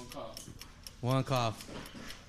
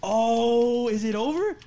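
excited cheering; 10 semitones higher, 6 dB louder, slower than usual. Real, not acted. Cheering friend holding in cough.

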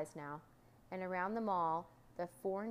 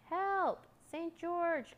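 high, urgent cry for help; calling out; 10 semitones higher, 5 dB louder, much slower than usual. Acted. Doctor dodging the dragon among trees, high calling cry. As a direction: call high and loud for rescue. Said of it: "called the doctor".

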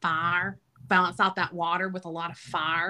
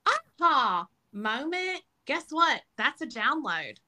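airy breathy mystical healer; 6 semitones higher than usual. Acted. Spiritual teacher revealing divine inspiration.

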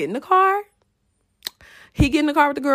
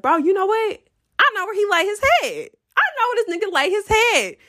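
excited realization; 10 semitones higher than usual. Retold or imitated. Retold scheming realization.

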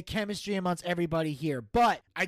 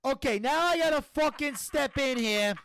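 higher-pitched Italian-American mobster voice; exasperated mob Don; 7 semitones higher than usual. Acted. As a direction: step in as an annoyed mafia boss. Plays the Don, mobster figure.

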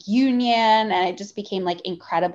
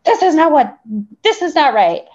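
raised, louder outraged objection; retold indignant protest; 7 semitones higher, 6 dB louder than usual. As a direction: push back hard on the unfairness. Retelling protest to her employer.

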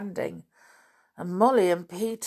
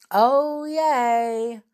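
long, high, loud call; town crier's cry; 5 semitones higher, 6 dB louder than usual. Acted. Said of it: "crying loudly".

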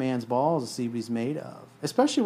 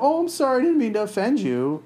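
high, pleading mock apology; mocking a beta male who apologizes to the girl, high and pleading; 11 semitones higher, 4 dB louder than usual. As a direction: whine it like a groveling beta male. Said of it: "a beta male will be like".

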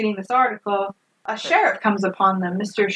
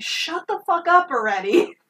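higher-pitched imitation of fed-up irritation; mock exasperation; 7 semitones higher than usual. Bonnie turning on screaming Blanche, mock exasperated. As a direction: act fed up, without raising the volume.

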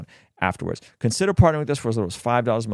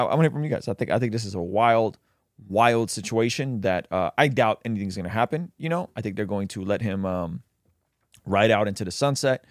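ordinary delivery

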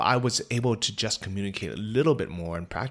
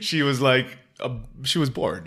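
higher, louder with a laugh; 4 semitones higher, 4 dB louder than usual. Real, not acted. Joking that the granny was just bored, laughing. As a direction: giggle through it.

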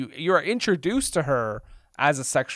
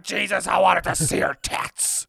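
loud, higher comic bellow; mock furious outburst; 5 semitones higher, 8 dB louder than usual. Imagined producer cameo in the movie, mock angry shout. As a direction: explode as a thwarted producer.

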